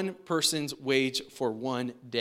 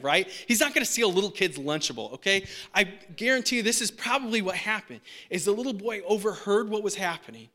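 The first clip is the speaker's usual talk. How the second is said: ordinary talk, nothing marked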